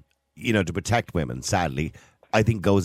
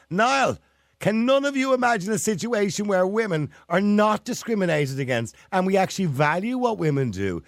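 impassioned; 9 semitones higher than usual. Real, not acted. Irate caller argues.

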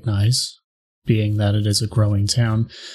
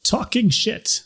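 townsperson voice; 7 semitones higher, slower than usual. Acted. NPC argues with the player.

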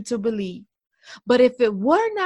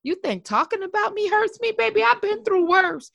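high, sassy clapback; 10 semitones higher, 4 dB louder than usual. Retold or imitated. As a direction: toss it off with attitude. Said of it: "you can look back and say".

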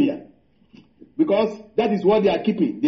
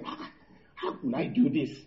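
low, soft retold complaint; reluctant murmur; 5 semitones lower, 9 dB quieter than usual. Preacher retells balking at the Lord's task, reluctant murmur. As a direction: voice an inward protest softly, dropping low.

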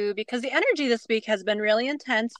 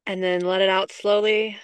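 guided grounding. Acted. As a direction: ease listeners into their breath. Leads a grounding exercise.

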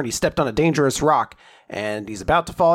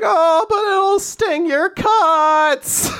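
very high, drawn-out mock wail; hammed-up whine; 5 dB louder, much slower than usual. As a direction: whine in falsetto, stretching every word. Acts out an exaggerated protest.

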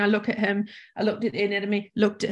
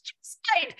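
high, frightened call to the landlord; panicked call; 3 dB quieter than usual. Retold or imitated. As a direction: cry out in high-pitched panic. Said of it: "I yelled out to her".

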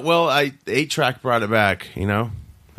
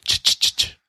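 creepy Friday the 13th whisper. Pretend. Horror movie sound effect.

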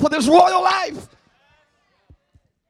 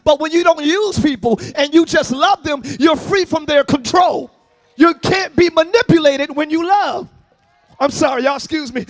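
usual speaking voice

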